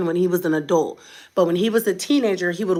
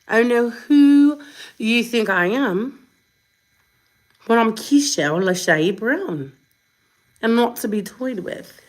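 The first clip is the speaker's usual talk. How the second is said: put-on slow, playful British accent; 4 semitones higher than usual. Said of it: "now I'm doing my British accent".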